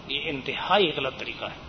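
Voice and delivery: normal talking voice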